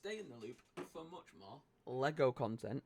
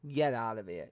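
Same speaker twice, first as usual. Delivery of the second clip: ordinary delivery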